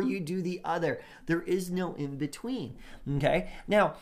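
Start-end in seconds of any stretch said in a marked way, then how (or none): none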